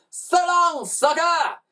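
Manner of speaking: high, gleeful shout; shouted taunt. A narrator's reading. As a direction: shout a jeering goodbye. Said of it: "they shouted".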